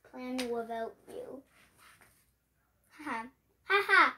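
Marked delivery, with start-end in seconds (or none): 3.7-4.1 gloating laugh. Acted. Gloating over success.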